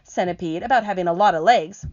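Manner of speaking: high, loud, grumbling earthworm; indignant earthworm. Acted. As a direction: set the record straight, offended. Earthworm voiced in story.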